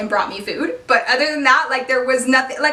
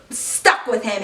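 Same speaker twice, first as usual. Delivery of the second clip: ordinary talk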